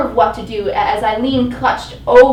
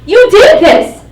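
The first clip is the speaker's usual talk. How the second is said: much higher and louder, hit "did"; furious accusation; 12 semitones higher, 15 dB louder than usual. Acted. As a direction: accuse him with all her rage. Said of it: "I spit at him".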